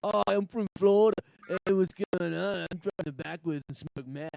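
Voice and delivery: mock Florida accent. Host showing the only accent his friend knows, hammed-up Florida accent. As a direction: start booming, then drag out a Floridian drawl. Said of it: "The only accent he knows is".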